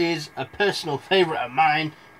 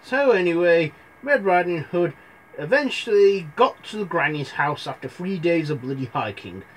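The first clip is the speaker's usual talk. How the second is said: usual speaking voice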